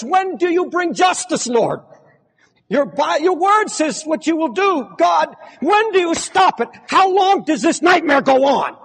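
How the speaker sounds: high, loud anguished shout; crying out to God. Retold or imitated. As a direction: cry out like a martyr demanding justice. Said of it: "And they cry out".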